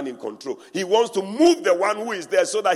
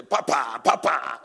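mock flattering titles; 3 semitones lower than usual. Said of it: "they are calling you".

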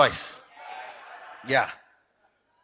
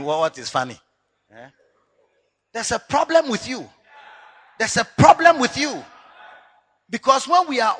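usual speaking voice

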